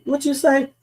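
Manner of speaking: ordinary talk, nothing marked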